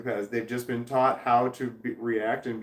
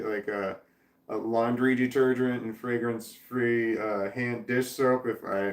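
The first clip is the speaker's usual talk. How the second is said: ordinary talk, nothing marked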